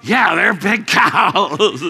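slow, loud, laughing through the words. Real, not acted. Preacher laughing as he repeats the boy's words.

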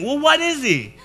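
high-pitched exasperated question; mock confusion. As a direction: ask it in comic bewilderment. Confused disciples react to Jesus.

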